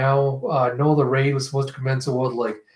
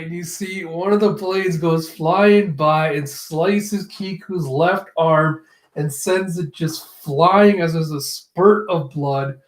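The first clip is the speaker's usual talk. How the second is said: ordinary delivery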